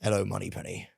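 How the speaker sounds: a hint of a suave Bond, quiet and low; Bond impression. Teasing Pete with a quiet Bond line. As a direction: murmur it like a lightly voiced Bond. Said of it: "sort of like".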